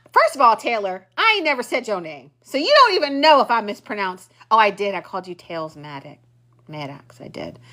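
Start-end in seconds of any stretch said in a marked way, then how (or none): none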